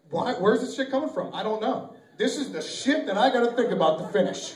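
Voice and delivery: ordinary delivery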